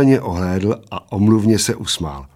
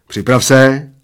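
loud, raised command; 4 semitones higher, 6 dB louder, slower than usual. A narrator's reading. Bald man starts the contest, commanding. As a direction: command him to get ready. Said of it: "zavelel Plešatec".

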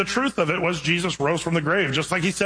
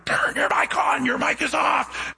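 frantic shout; 9 semitones higher, slower than usual. Retold or imitated. Lady on the floor yelling at the pastor, shouting. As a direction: yell it up at the pastor, desperate. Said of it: "laying down on the ground going".

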